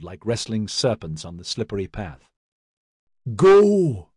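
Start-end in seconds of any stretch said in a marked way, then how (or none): 3.4-4.1 loud, high-pitched yelled order. A narrator's reading. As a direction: yell it out hard as one long word. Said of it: "Maxon yelled".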